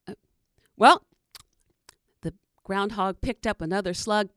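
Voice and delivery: plain reading voice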